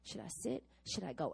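hushed back-and-forth worrying; inner dithering. Retold or imitated. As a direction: mutter the dilemma to yourself. Inner debate on her chair.

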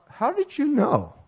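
high, slow, amazed asking; surprised question. Retold or imitated. Taxi driver amazed that a stranger guessed his degree, high and slow. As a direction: let the wonder lift the question up high.

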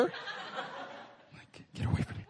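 breathy, laughing mock brush-off; can't keep a straight face; giggling get away from me; laughing through the words. Real, not acted. Laughing through retold line.

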